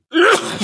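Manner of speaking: a sharp real sneeze; sneezing. Real, not acted. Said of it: "Verzeihung, ich musste niesen". (plötzliches Niesen)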